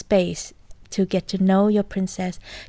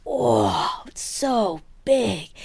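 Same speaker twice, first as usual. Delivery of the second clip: big awed exclamation, stretched out; fierce, big love; much slower than usual. Acted. Priestess loving fiercely, shown to the host, big and awed. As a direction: voice the priestess's love as something huge. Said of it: "She loved fiercely".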